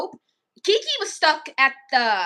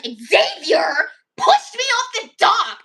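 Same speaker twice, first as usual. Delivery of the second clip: put-on upset kid accusing; 4 semitones higher, 7 dB louder, much slower than usual. Kid accusing Xavier at camp.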